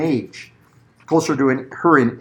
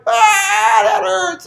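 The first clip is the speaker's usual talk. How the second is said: 模仿小孩被电到时尖高的哭喊; 音调拔高，假装孩子吃痛大叫; imitating child's yelp; much slower than usual. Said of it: "they're like".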